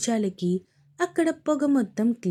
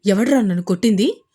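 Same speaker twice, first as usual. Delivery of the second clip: angry shout; 7 dB louder, faster than usual. Acted. Fight, attacker turns around.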